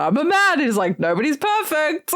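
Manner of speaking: high voice breaking into a laugh; high-pitched quote. Retold or imitated. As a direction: deliver the punchline high and giggly. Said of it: "he ends with".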